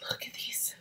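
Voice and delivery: soft breathless whisper. Real, not acted. Showing viewers the markers, whispering. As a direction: whisper like a secret treasure.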